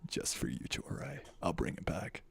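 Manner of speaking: low whispery seductive voice; sultry Riddler. Acted. Streamer brings back the villain voice for a friend, sultry whisper. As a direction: purr it just for them.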